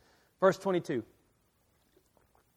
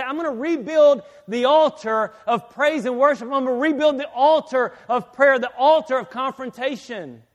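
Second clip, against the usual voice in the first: loud, raised-pitch preacher's vow; rousing declaration; 4 semitones higher, 5 dB louder than usual. Pretend. Preacher voicing whoever stands up in church, rousing declaration.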